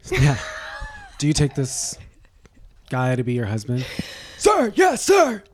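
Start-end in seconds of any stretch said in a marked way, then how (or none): 4.4-5.4 shouted high "Sir, yes, sir" like a recruit; mock drill sergeant reply. Answering the wedding vow like a recruit, shouted high. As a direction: bark it back like a soldier on parade.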